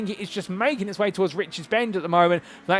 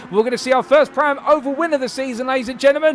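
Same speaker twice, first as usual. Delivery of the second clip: high, loud photo-finish commentary; excited finish call; 6 semitones higher, 3 dB louder than usual. Real, not acted. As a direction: call the chequered flag at full pitch. Final lap finish, photo finish.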